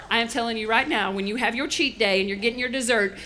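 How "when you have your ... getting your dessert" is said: ordinary delivery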